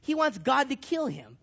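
normal talking voice

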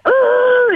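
high startled cry; startled yelp. Retold or imitated. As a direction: jump at a sound in the dark. Frozen in fear in dark.

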